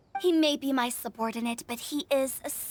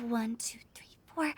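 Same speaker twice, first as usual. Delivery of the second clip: whispered count; 4 semitones lower, 7 dB quieter than usual. Real, not acted. The director counting the guests in a whisper. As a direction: whisper the numbers quickly. Counting heads under her breath.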